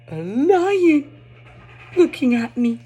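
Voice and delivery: put-on thrilled reveal for a small child; excited storytime. Shown as a demonstration. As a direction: wow a toddler with the lion. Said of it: "reading it this way will be very entertaining".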